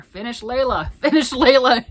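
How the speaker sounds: playfully clamor for it, breaking into a laugh; 장난스럽게 조르는 외침. Acted. Quotes commenters demanding she finish it.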